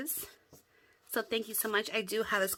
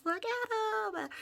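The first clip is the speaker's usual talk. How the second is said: high, slow, drawn-out coo; cooing at cute pom-poms on camera; delighted squeal; 13 semitones higher than usual. Real, not acted. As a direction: coo over them, stretched out.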